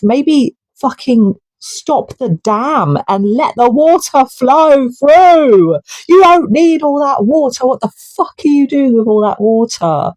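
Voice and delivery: yelling at the hoarders to open the dam; real anger. Real, not acted. Vlogger venting at the billionaire class, angry shout. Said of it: "I get really angry".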